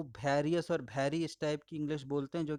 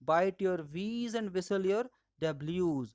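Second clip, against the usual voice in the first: stretched out word by word; slow, emphatic classroom mantra; very slow rule; 2 semitones higher, much slower than usual. Shown as a demonstration. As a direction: drill the rule into memory. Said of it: "remember the rule".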